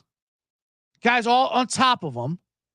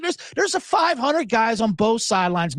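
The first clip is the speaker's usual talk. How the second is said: ordinary delivery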